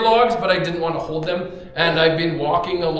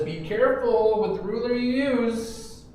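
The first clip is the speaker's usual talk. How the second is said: voicing Jesus; 6 semitones higher than usual. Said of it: "And Jesus says".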